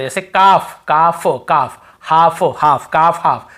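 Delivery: काफ and हाफ said slowly without any L; silent L demo. Shown as a demonstration. Scene: teacher showing students the silent L, deliberate demo. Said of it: "L को यहाँ पे नहीं पढ़ना".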